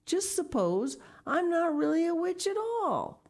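high-pitched anxious supposing; horrified doubt. A narrator's reading. Miss Pinchin fears she is no witch, horrified doubt. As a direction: let horror lift the voice high. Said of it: "her eyes opened wide with horror".

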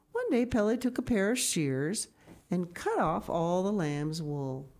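bright, lifted read-aloud storytelling; storybook narration. A narrator's reading. Reading a picture book aloud to children, lifted storytelling. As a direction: tell it like a bedtime story to the kids.

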